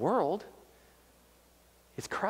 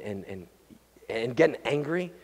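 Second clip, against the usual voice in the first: ordinary delivery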